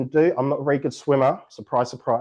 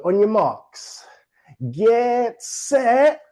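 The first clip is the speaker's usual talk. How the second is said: race starter call; 6 semitones higher, 4 dB louder, much slower than usual. Shown as a demonstration. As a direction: get set called out high and loud. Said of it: "race starter call".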